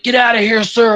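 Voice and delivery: high-pitched movie soldier's order; imitating a desperate soldier; urgent battle cry. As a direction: call it out urgently under fire, pitched high. Acted battle retreat movie quote.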